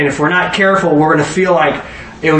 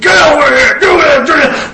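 much higher and louder coach yell across the field; coach shouting; 11 semitones higher, 5 dB louder than usual. Retold or imitated. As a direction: bark orders at players on the field. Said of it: "Remind me of a coach talking".

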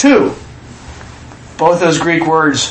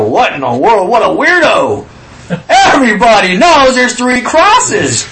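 mock scoffing; 4 semitones higher, 3 dB louder than usual. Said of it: "they go".

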